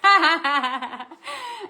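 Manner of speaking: long, high, loud laugh. Real, not acted. Host tickled by knowing the song, real laugh. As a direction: let a big laugh run out. Cracks up at her own rapping.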